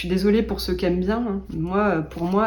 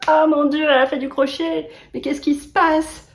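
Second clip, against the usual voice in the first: feigned shock, higher and louder; mock astonishment; 8 semitones higher, 4 dB louder than usual. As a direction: play it up like big news about yourself. Mock shock at her own crochet. (fausse stupeur, plus aiguë et plus forte)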